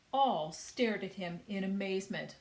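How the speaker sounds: ordinary delivery, nothing marked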